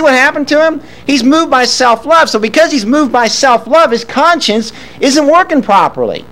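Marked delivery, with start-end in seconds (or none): none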